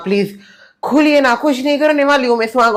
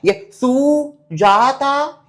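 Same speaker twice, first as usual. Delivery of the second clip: mocking stretched name; 2 semitones higher, much slower than usual. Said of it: "ऐसा gap लेके क्यों बोल रहे हैं?".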